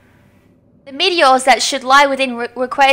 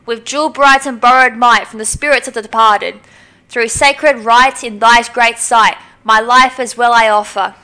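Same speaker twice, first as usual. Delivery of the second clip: solemn incantation. Acted. Priestess summoning the dragon to save the world, solemn chant. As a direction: call on the ancient dragon like a priestess.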